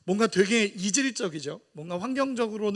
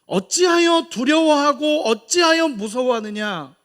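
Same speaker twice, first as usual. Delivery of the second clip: Jesus rebuking; 6 semitones higher, 7 dB louder than usual. Acted. Preacher voices Jesus' rebuke.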